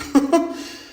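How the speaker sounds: brief high chuckle; short laugh. Real, not acted. Recalling the cousin who got them drunk, short laugh. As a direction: chuckle at the memory.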